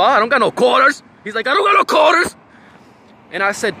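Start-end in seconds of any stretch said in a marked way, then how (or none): 0.0-1.0 louder, higher mock protest; raised-pitch quoted denial, rising at the end; retold denial. 1.5-2.3 high, shouted mock denial; loud, high-pitched retold protest; imitated shout.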